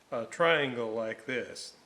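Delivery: ordinary delivery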